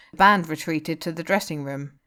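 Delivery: usual speaking voice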